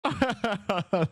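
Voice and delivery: high burst of giggling; amused, falling laugh. Real, not acted. Laughs at own goof.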